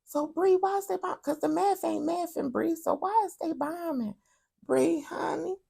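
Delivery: very high, soft, mock-innocent asking; falsetto questioner. Pretend viewer asking Bree why, in high soft falsetto. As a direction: squeak the question at Bree sweetly.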